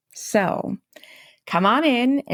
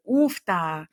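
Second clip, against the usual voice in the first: Minnesotan oofta. Shown as a demonstration. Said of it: "you just say".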